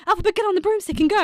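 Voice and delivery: loud, fast, urgent plea; urgent command. Acted. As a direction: hurry her off, loud and fast. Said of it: "Elphaba's like".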